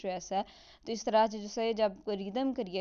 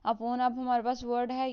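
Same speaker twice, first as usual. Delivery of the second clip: ordinary delivery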